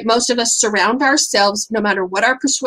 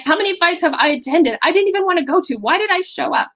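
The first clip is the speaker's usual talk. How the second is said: higher and fast, amazed at herself; incredulous self-questioning; 7 semitones higher, faster than usual. Real, not acted. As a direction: ask it like you can't believe you did it. Speaker's rhetorical self-questioning.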